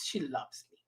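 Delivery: quiet, low murmur; soft aside. Real, not acted. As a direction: drop the voice, just to yourself. Said twice, differently.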